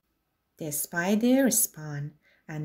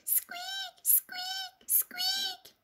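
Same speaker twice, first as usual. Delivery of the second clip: tiny high whispered squeaks; mouse squeak; 12 dB quieter, much slower than usual. A narrator's reading.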